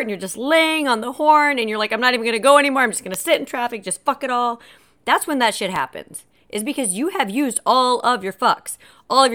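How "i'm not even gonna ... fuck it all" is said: higher, fast-running exasperated rant; mock meltdown. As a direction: act out giving up in a traffic jam. Acted giving up in traffic jam.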